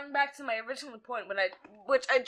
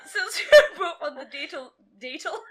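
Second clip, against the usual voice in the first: laughing through the words; 5 semitones higher, 7 dB louder than usual. Real, not acted. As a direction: giggle while stumbling.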